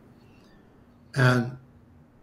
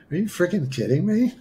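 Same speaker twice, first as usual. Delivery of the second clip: high-pitched mock disbelief; incredulous; 6 semitones higher than usual. Atheist answering the voice calling him healer, incredulous. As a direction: act out an amazed protest, pitched up. Retold reaction to being called healer.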